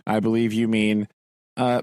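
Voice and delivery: ordinary delivery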